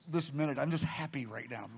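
low, soft and spent; exhausted happy murmur; wrung out but happy. Retold or imitated. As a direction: trail it out wearily, content. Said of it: "Wrung out, but happy".

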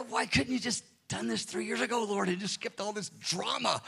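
soft, strained grumble to God; exasperated complaint. Retold or imitated. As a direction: imitate a weary, muted protest.

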